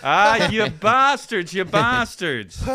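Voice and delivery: slow, loud, laughing curse. Acted. Local laughs along at joke.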